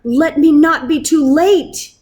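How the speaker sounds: frightened father pleading with God; desperate cry. A narrator's reading. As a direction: beg God as you run. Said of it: "he cried".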